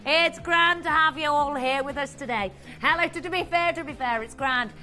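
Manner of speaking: light mock Irish lilt, playful; a hammed-up hint of Irish for the viewers; Irish accent. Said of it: "love, love an accent here".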